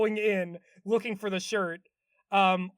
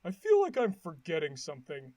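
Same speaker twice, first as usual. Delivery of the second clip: Patrick; 4 semitones lower than usual. Retold or imitated. Patrick pondering after the circus, low puzzled character voice. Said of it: "And then he goes".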